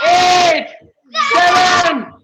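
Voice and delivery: loud countdown shout; counting down to midnight, cheering; new year countdown crowd. Real, not acted.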